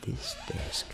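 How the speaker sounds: hushed, very soft start. Shown as a demonstration. Teacher starting the full exercise, hushed. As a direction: barely voice the words. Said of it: "Vamos fazer tudo".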